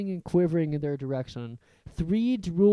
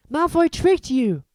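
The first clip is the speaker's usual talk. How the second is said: Hermione; 11 semitones higher, 7 dB louder than usual. A narrator's reading. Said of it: "Hermione said to Harry".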